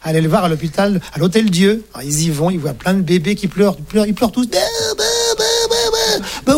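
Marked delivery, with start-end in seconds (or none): 4.5-6.6 put-on high wailing baby cries; imitated baby crying. Man imitating crying babies in a hospital nursery, high wailing. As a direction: bawl like a newborn.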